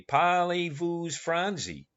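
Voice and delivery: slow, mildly put-on French accent; a slow, lightly voiced French greeting; mock French. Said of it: "Suppose a man was to come to you and say".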